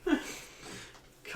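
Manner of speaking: laughing. Real, not acted. Joking about scary back roads.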